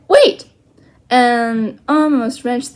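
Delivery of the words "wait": sharp, high, loud cry. Acted. Omri cries out to Little Bear as he leaps up. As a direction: yelp it in a rush. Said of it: "He cried".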